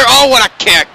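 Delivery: high, loud burst of delight; excited call. Real, not acted. Commentator hyping a big kick for viewers, loud and excited. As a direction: cheer the kick as it lands.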